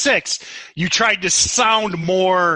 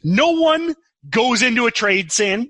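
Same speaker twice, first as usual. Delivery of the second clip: ordinary delivery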